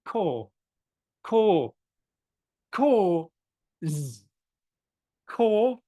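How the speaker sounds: core with a short, sharp K. Shown as a demonstration. Teacher drilling core for the student. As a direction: core with a crisp, hard K and no puff. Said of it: "Very sharp".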